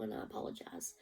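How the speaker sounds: real whisper, voice gone from emotion; softly whispered, emotional apology. Real, not acted. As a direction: whisper the apology, near tears. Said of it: "I'm a little bit emotional".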